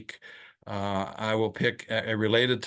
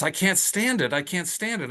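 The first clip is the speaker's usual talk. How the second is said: desperate patient; 8 semitones higher than usual. Retold or imitated. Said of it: "He says".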